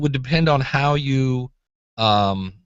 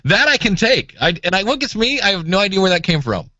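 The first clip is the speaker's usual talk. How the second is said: excited; 7 semitones higher, 3 dB louder than usual. Real, not acted. Laughing after impression.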